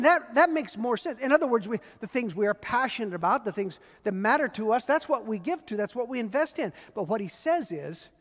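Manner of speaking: ordinary delivery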